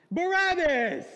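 loud, high yell of the crowd; the crowd screams its choice, loud and high; crowd shout. Retold or imitated. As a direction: yell the name like a mob. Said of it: "And they're like".

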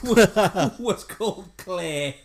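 loud, high, broken up by laughter; laughing while talking. Real, not acted. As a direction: struggle to get it out through laughter. Cracking up while telling it.

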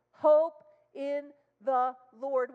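slow, high, loud repeat; emphatic proclaim. Real, not acted. Preacher repeating the verse to the church, slow and loud. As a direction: drive the words home slowly.

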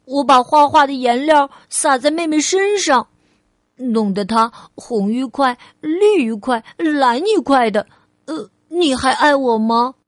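put-on eager childlike questioning; little girl Ali, testing. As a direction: play a small child posing a what-if. Child character asks mother.

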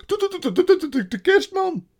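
high, loud rapid-fire stutter; stammering fox. A narrator's reading. Fox confesses to the witch, stammering in fear. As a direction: get stuck on the first syllable, panicking. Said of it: "hakkelde de vos".

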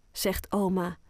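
plain reading voice

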